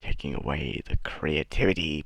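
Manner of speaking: low put-on voice; put-on mocking voice. Vlogger mimicking a complaint about creativity, low voice. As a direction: drop low into a mock character voice.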